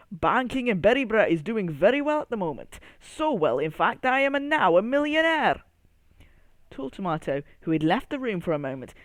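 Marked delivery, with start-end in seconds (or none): 0.1-5.6 put-on Scottish accent. Scottish accent of Banker Berry.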